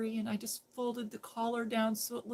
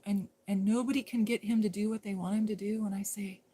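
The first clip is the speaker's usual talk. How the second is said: ordinary talk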